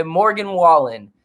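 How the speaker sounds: ordinary talk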